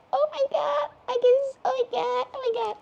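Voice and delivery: high-pitched fangirl squeal; breathless high oh my God; squealing excitement. Real, not acted. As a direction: squeal it like you just saw your idol.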